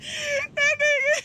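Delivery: high, slow wailing shout; wailing cry. Retold or imitated. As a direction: wail it out dramatically. Crying shout.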